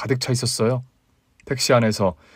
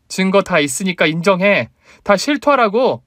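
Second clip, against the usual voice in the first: high, raised voice building to a yell; angry demand; 7 semitones higher, 4 dB louder than usual. A narrator's reading. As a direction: press hard for a confession. Wife angrily demands husband's public confession.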